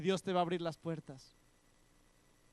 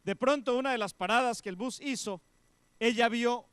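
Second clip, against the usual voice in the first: loud, high, urgent preaching; preacher reaching the big moment; rising preacher excitement; 9 semitones higher, 10 dB louder than usual. Acted. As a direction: build the suspense loudly. Preaching climax.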